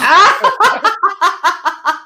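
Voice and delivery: high, loud cackle; big laugh. Real, not acted. Host cracking up at thought of mom watching, loud laugh.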